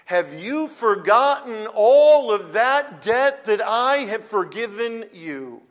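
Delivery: loud, high, slow rebuke from a king; emphatic reproach. Acted. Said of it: "The king is emphasizing the, the sheer size".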